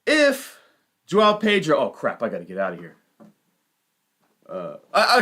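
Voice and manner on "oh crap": ordinary talk, nothing marked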